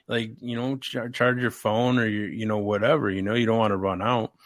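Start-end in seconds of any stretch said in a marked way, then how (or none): none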